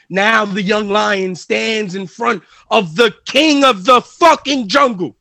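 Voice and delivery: heated promo to a rival; fired-up wrestling promo, pushing hard. Acted. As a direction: talk trash to the champ before the big match.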